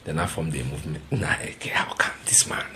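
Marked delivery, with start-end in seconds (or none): 1.1-2.6 hushed, disbelieving aside; whispered aside to the audience; incredulous whisper. Real, not acted.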